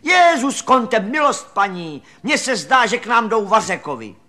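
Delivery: high, loud cry from the maid; silný výkřik. A narrator's reading. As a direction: call out loudly, pitched well up. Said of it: "vykřikla silným hlasem". (vykřiknout silným hlasem)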